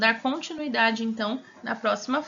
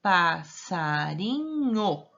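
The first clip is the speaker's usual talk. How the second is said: passarinho stretched slowly, syllable by syllable; arrastado, sílaba por sílaba; 4 semitones lower, much slower than usual. Shown as a demonstration. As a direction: draw out passarinho for young readers. Teacher shows word syllable by syllable. (passarinho bem devagar, sílaba por sílaba)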